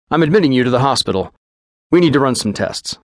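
quick, businesslike physician giving orders; lightly voiced clinical doctor; brisk doctor.

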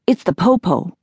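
usual speaking voice

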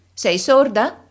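high, loud scolding shout; countess shouting. Acted. Countess scolding Lisa, high loud shout. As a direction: snap at her like she's deaf. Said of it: "gridò la contessa".

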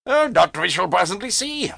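high, knowing German man; sly German; German accent. A narrator's reading. German voiced.

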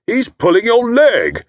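loud, high, jovial teasing; hearty old fellow. A narrator's reading. Jovial host teasing Tommy about his wife, hearty. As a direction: slap him on the back with it. Estcourt cuts in.

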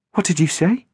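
a hint of alarm in her voice; startled. A narrator's reading. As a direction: narrator voicing a frightened girl on the phone. Girl startled by the name.

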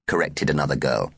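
plain reading voice

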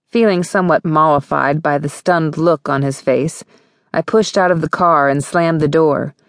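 plain reading voice

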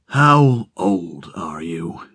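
low, slow, otherworldly voice from the fog; a deep yet hollow stranger asks slowly; strange eerie voice. Acted. Unseen stranger questions Rickert in the fog, low and slow. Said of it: "It had a strange timbre".

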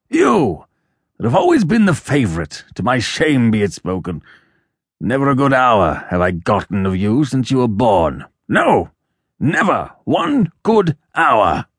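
put-on old father's bitter outburst; crying out. A narrator's reading. Father rebuking his favourite son, crying out bitterly. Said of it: "cried his father".

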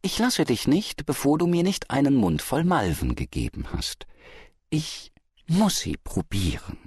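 honeyed pestering; cooing. A narrator's reading. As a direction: coax sweetly for a taste. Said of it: "säuselte die Frau".

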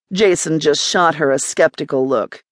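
ordinary delivery, nothing marked

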